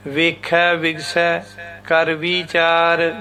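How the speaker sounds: ਵੇਖੈ ਵਿਗਸੈ with open ai endings, slow; correct pronunciation. Shown as a demonstration. Teacher modelling the correct reading, slow and clear. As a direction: recite slowly with ai endings. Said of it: "ਇਸ ਤਰ੍ਹਾਂ ਬੋਲਣਾ".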